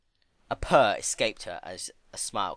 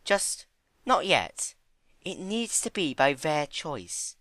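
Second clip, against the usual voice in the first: very high, slow, timid pony voice; gentle Fluttershy; 8 semitones higher, slower than usual. Acted. Fluttershy holding back Discord, high, slow and timid. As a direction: ease into it, timid.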